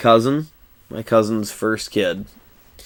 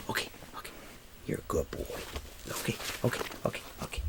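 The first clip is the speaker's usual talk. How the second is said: soft unvoiced whisper to a pet; whispering to the dog; 4 semitones lower, 21 dB quieter, much faster than usual. Real, not acted. Whispering to his dog Nugget to settle him. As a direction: hush the puppy gently.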